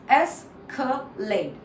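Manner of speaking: three separate parts. Shown as a demonstration. Teacher splits the word for learners, slow syllables. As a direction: escalate broken slowly into es, ca, late. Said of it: "So there are three parts".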